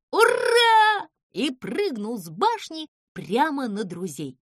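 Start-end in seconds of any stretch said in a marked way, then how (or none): 0.1-1.1 high, loud, drawn-out battle cry; sudden high whoop; shouted hurrah. A narrator's reading. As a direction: yell it as you leap. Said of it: "Неожиданно Зыза крикнул".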